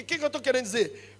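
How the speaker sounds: ordinary talk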